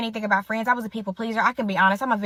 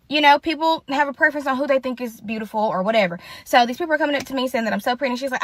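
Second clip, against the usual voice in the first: usual speaking voice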